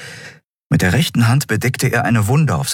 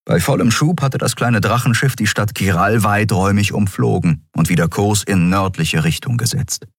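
usual speaking voice

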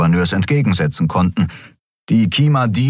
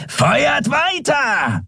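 high, long-drawn shouted order; roared command; much slower than usual. A narrator's reading. Shadow Skria ordering his men to fire, roaring. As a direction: roar the order, stretching every word. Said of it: "brüllte der Schattenskrier".

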